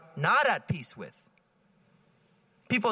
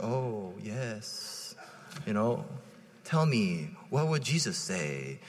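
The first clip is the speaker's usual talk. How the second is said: imitating a calm priest; 9 semitones lower, 4 dB quieter, slower than usual. Narrator imitates the retired pastor fresh from meditation, serenely calm. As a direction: lower and softer, a serene unhurried priest. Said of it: "he's, like, so calm".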